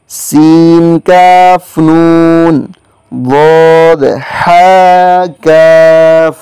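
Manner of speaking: slow, measured spelling of Arabic letters; slow letter drill. Shown as a demonstration. Teacher spelling out three-letter compounds for students, slow and measured. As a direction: spell each letter out deliberately for students to repeat.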